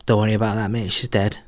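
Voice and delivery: rough attempt at a Manchester accent; Manc accent. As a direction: try a slightly off Manc voice. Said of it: "I tried to do a Manc accent".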